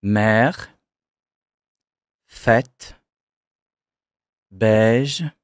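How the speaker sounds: Mère, fête, beige with an open French è; è ouvert. Shown as a demonstration. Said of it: "That too is pronounced E".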